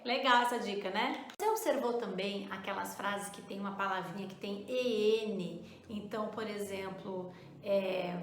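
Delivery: usual speaking voice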